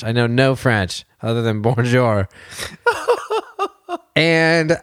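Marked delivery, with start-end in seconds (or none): none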